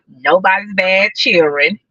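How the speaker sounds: ordinary delivery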